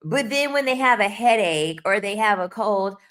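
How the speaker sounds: ordinary delivery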